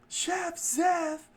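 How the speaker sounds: very high chefs calling the name; falsetto call. A narrator's reading. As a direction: cry out the chef's name high. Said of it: "everyone said".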